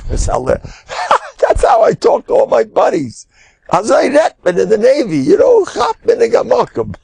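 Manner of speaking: high, hollered explanation with laughs; mock laughing it off. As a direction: laugh it off as buddy talk. Older sailor laughs off the curses.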